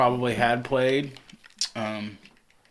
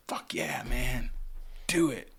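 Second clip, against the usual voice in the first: put-on whispered, urging older men; hushed retold cheer; 7 dB quieter, slower than usual. Older men urging the young singer on, whispered. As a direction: whisper it like a nod of approval. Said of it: "looking at me like".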